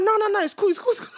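high-pitched, repetitive mock reassurance; retold breezy dismissal. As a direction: brush the worry away in a high voice. Retold brushing off friend's worry.

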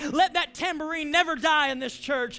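fervent preaching prayer over music; impassioned prayer. Real, not acted. Pastor leading the church in prayer over music, impassioned. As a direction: pray it out with rising conviction, then settle.

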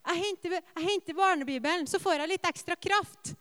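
put-on a small boy proud of his plan; excited little boy. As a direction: son announcing he will get the children's Bible. Son fetching the children's Bible.

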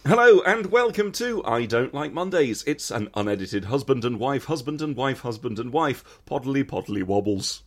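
big, loud burst into a podcast welcome; show intro. Acted. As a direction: announce the show with a playful flourish. Opens the show.

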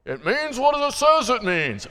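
lightly mock the stubborn old teacher; old timer. Preacher mocking old-timer Bible teachers, lightly voiced. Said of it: "what's the old timer say".

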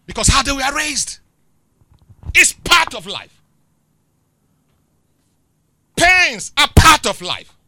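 loud, slow, high preacher proclamation; preaching shout. Real, not acted. Preacher proclaiming to the congregation that pain is life, loud and slow. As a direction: proclaim it to the whole church.

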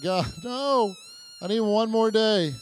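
high, loud whiny cry drawn out slowly; mock crying plea. Pastor acting out waking on Monday morning, mock crying. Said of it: "Just crying like".

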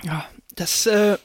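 breathless whisper after coughing; recovering from choking. Real, not acted. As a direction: still catching breath. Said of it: "ich regeneriere noch".

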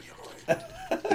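voiceless, soundless, kind reassurance; mouthed reassurance. Retold or imitated. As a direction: mouth it across the stage without voice. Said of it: "James is mouthing at me".

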